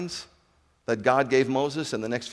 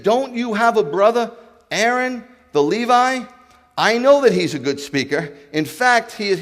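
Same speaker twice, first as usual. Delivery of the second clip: higher and louder, exasperated; angry God; 8 semitones higher, 7 dB louder than usual. Acted. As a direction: blaze up at him.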